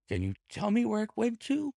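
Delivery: very high, pleading question; quivering with emotion. A narrator's reading. Ryder begging Holmes for news, quivering with emotion. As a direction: beg for the answer in a high voice. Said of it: "Ryder quivered with emotion".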